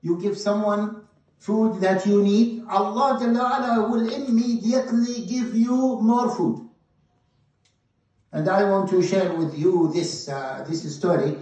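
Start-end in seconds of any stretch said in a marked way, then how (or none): 0.0-6.7 loud, high-pitched preaching; forceful preaching. Acted. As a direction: drive the lesson home to the congregation. Preacher exhorts the brothers.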